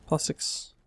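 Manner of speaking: plastics whispered to himself; whispering. Real, not acted. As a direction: breathe the word plastics unvoiced.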